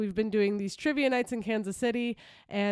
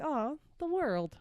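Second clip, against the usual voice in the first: slow, soft, tender aww; touched aww; 4 semitones higher, 5 dB quieter, slower than usual. Retold or imitated. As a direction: melt a little, softly. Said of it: "and you're like".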